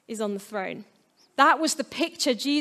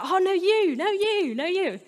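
a light mock of polite guests insisting, pitched higher; overly polite guest; 7 semitones higher than usual. Preacher mocks polite dinner guests, higher playful insisting. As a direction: playfully act out guests politely deferring, no you. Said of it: "a really British dinner party".

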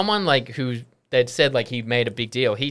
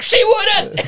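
high squeal of a line broken by laughter; laughing mock retort; 7 dB louder than usual. Rugby lads jeering back at comic, laughing high-pitched retort. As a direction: crack up as you jeer it. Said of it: "They're like".

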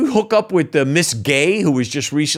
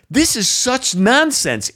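exasperated; 11 semitones higher, slower than usual. Real, not acted. Exasperated outburst.

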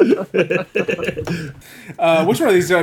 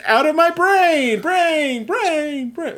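dramatic build; 12 semitones higher, much slower than usual. Pretend. Dramatic spooky brain chant.

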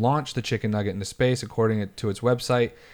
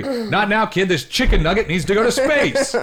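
dismissive man; 9 semitones higher, 5 dB louder than usual. Acted. Skit, dismissive man again.

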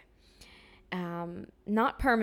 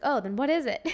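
puzzled; 5 semitones higher, 6 dB louder than usual. Retold or imitated. Puzzled inner voice in anecdote.

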